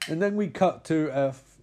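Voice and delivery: ordinary talk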